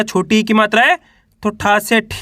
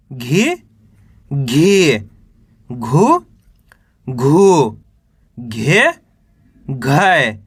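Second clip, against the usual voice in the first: lower, each syllable set apart by pauses; syllable drill; 8 semitones lower, much slower than usual. Shown as a demonstration. Teacher reciting the ga row to students, lower and deliberate.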